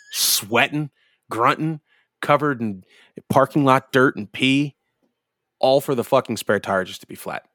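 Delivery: normal talking voice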